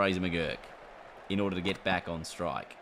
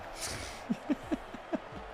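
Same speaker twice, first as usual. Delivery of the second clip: high laugh; 6 dB quieter than usual. Real, not acted. Laughs after bad shot.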